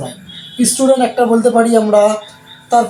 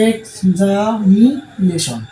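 Examination very slow, in four chunks; split into syllables; 2 semitones lower, much slower than usual. Shown as a demonstration. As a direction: pause between each part of examination. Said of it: "চারবার ভেঙে যেগুলো উচ্চারণ করা".

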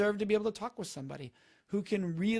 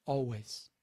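quiet, lower repeat; gentle lower afterthought; soft echo; 7 semitones lower, 4 dB quieter than usual. Shown as a demonstration. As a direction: let the word land softly. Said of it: "And say the following words".

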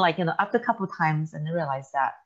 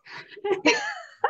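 high burst of laughter. Real, not acted. Laughing about homeschooling.

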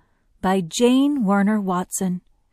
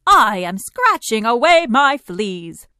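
loud, slower gorilla voice; big gorilla; 4 semitones higher, 6 dB louder than usual. A narrator's reading. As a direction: take it slowly and loudly, as a gorilla. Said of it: "said the big gorilla".

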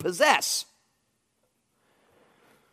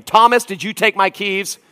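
louder, higher mock scolding; barking at the dog; 6 semitones higher, 8 dB louder than usual. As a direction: bark at the dog over the keys. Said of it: "I bark at him".

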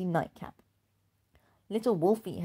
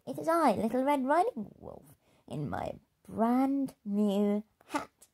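higher, slow proud announcement; proud little wolf; 6 semitones higher than usual. Acted. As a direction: present himself in his new hat. Wolfie announces himself.

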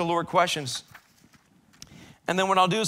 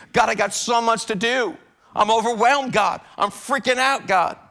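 higher, louder, building panic; frantic complaint; 6 semitones higher, 5 dB louder than usual. Acted. Overwhelmed believer cries out to God, building frantic complaint. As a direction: spill it out in a rush of panic.

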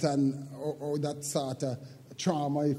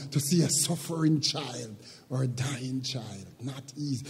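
usual speaking voice

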